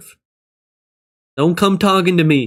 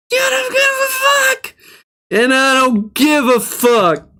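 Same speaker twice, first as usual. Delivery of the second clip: screaming rant. Real, not acted. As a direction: scream it all at the top.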